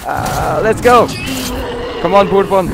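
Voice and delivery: high, loud shout to hurry; urgent calling out. Real, not acted. Gamer urging his companion onward, urgent shout. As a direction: holler at a lagging partner to move.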